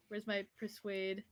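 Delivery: usual speaking voice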